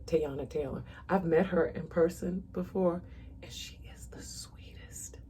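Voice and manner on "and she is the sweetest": whispered, soft and confiding. Real, not acted. Telling viewers about meeting [name], in a soft whisper. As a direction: let it out in a hushed whisper.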